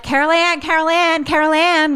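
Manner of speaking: high anxious calling of a name. Retold or imitated. Siblings searching the house for their sister, calling out high.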